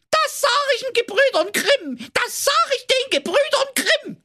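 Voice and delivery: very high, howling crybaby wail; a hammed-up childish howl while running away. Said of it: "Da heulte der böse Zwerg".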